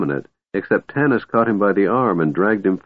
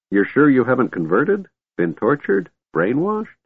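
put-on high, rising old man's queries; hopeful questioning; 6 semitones higher than usual. A narrator's reading. Old man checks whether Tanis was converted, hopeful questioning. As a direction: press on hopefully, pitch climbing each time.

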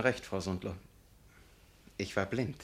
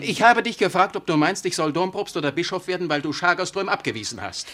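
high, loud bitter demand; raised indignant voice; angry accusation; 6 semitones higher, 6 dB louder than usual. Acted. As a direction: throw the accusation back at her. Vicar confronts Charlotte.

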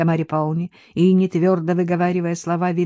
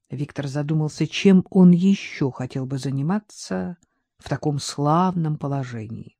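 ordinary delivery

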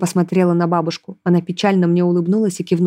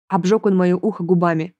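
ordinary delivery, nothing marked

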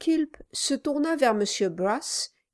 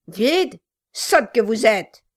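gruff gravelly dwarf voice; 2 semitones higher, 8 dB louder than usual. Acted. Dwarf scolding his wife.

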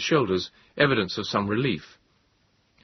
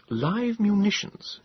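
higher, slow, reading aloud; Moses voice; 12 semitones higher, much slower than usual. A narrator's reading. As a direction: read it out slowly, higher. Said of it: "he read".

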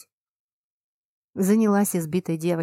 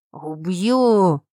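loud roared threat; roaring threat; 6 dB louder than usual. A narrator's reading. Injured husband threatens the heroine, loud roar. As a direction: roar it in rage. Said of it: "взревел мужик".

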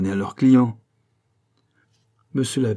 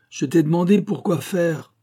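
high, insistent repeated question; impatient demand; 7 semitones higher, 4 dB louder than usual. A narrator's reading. Abbot repeats his question to Pitou, impatiently. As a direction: press him for an answer.

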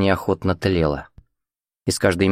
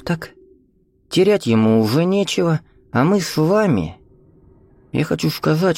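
put-on sly old man; 9 semitones higher than usual. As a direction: wheedle like a crafty old man. Narrator voices the old teacher.

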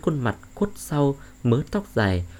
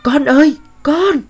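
very high, loud mother's scream; anguished scream; 11 dB louder than usual. Acted. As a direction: wail for your child, pitched far up and loud. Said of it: "Bà Diệu gào lên".

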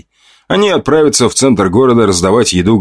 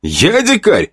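higher, offended question; indignant echo; 11 semitones higher, slower than usual. A narrator's reading. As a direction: throw the insult back in disbelief.

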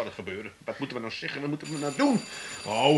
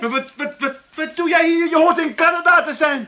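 high, louder cry of dismay; high shocked outburst; 11 semitones higher, 5 dB louder than usual. Acted. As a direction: voice jumps way up in alarm. Shock at brother's return.

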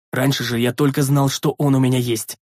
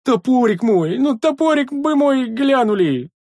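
very high, drawn-out pleading; sorrowful wail; 11 semitones higher, 4 dB louder, slower than usual. A narrator's reading. Sentry begging for his axe, wailing. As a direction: beg in a plaintive wail. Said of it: "горестный вопль давешнего часового".

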